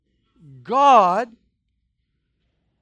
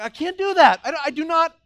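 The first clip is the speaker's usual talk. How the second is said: overwhelmed protest; 3 semitones higher, 7 dB louder than usual. Retold or imitated. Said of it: "You're like".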